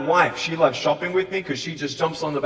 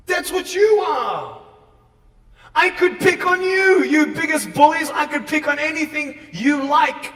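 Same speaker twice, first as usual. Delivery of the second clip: shouting at bullies; 9 semitones higher, 4 dB louder than usual. Real, not acted.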